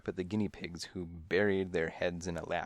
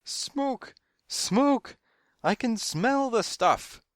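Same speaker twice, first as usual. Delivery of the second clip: very high, panicky screech; shrieking alarm; 6 dB louder, much slower than usual. A narrator's reading. Woman with parrots warns the waiting room, shrieking. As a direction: screech the warning. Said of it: "shrieked the woman with the parrots".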